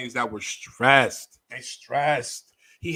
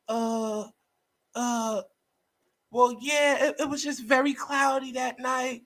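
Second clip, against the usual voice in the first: mocking a timid, proper courtroom voice, higher and hesitant; Megan on the stand; 8 semitones higher than usual. Mocking Megan testifying in court, higher timid proper voice. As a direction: go small and polite like a witness dodging. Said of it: "you start talking different".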